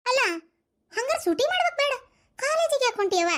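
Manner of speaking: shocked fussing. Acted. Fussing over pregnant girl.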